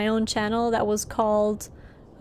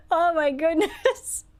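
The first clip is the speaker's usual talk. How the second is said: laughing; 8 semitones higher, slower than usual. Real, not acted. Laughing at funny visual.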